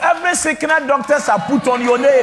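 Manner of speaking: loud, high proclamation of healing; shouted healing prayer. Real, not acted. Pastor shouting a prayer of healing over the sick congregation. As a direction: declare it over them at full voice.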